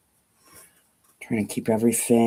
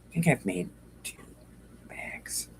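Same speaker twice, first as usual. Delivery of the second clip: trailing off into a whisper; 5 semitones higher, 11 dB quieter than usual. Real, not acted. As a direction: whisper the confession.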